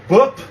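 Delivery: B said as a short, punchy bup; Korean B. Shown as a demonstration. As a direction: pop out bup. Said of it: "This is m".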